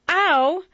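sudden high yelp as if pricked by a pin; stiff ow. Acted. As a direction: squeak it like a pinprick. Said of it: "more suggestive of pins".

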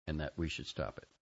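ordinary delivery

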